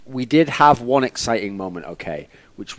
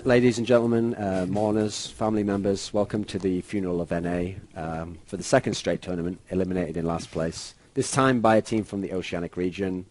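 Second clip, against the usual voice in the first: solemn mock eulogy, softer; funeral eulogy; 4 semitones lower, 6 dB quieter than usual. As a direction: sombre funeral speech, hushed.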